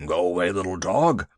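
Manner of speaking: low, nervous big beast; a huge elephant pleading with a tiny dog; timid elephant voice. A narrator's reading. Elephant begging the little dog to leave, low and nervous.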